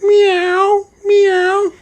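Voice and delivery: very high, drawn-out meow; cat meow. Acted. Fugitive fooling police from a tree, meowing like a cat. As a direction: mimic a cat in a tiny high voice. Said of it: "The Hawaiian goes".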